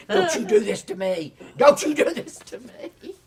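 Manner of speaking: loud, high-pitched panicked plea; mock panic. Casting director begging outside the network head's office, hammed-up panic. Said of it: "'Cause she was sweating".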